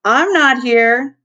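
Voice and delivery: sly, slow giggling line; snickering. A narrator's reading. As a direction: say it with a snicker, drawn out. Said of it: "she snickered".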